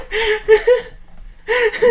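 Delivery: high-pitched burst of laughter; real laugh. Real, not acted. Admitting a great daughter name, high laugh. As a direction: crack up at yourself.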